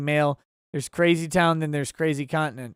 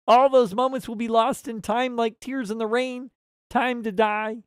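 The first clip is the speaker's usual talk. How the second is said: higher-pitched frog puppet voice; Kermit the Frog; 4 semitones higher than usual. Acted. Streamer reading a chat's movie monologue, high frog impression. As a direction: recite it solemnly in a puppet frog voice. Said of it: "Kermit the Frog says".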